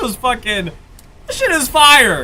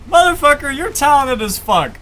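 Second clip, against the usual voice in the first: ordinary delivery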